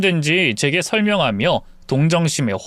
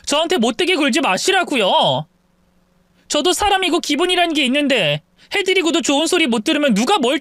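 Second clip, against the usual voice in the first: higher, indignant daughter-in-law; daughter-in-law finally speaking up, higher and indignant; upset daughter-in-law; 10 semitones higher than usual. Acted. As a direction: confront the mother-in-law, stung and fed up. Wife presses her point.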